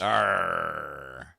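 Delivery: a hint of a pirate growl. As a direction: give a short pirate arr. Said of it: "National Talk Like a Pirate Day".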